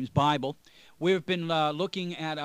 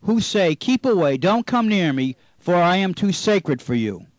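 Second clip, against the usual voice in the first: scripture reading; 7 dB louder than usual. Acted. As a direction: read the King James verses out like from a pulpit.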